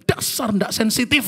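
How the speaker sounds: high-pitched mock scolding; imitated angry wife. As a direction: retort like an offended wife. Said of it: "makin marah dia denger itu".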